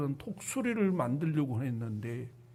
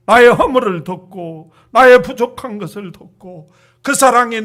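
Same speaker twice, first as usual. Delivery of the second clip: loud fervent preaching prayer; 10 dB louder than usual. Acted. Pastor praying for congregation after sermon, fervent loud prayer. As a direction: pour out the prayer with rising force.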